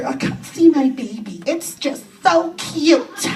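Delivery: put-on high, drawn-out baby-talk coo; syrupy high cooing; gushing mother. Mother gushing over ugly baby.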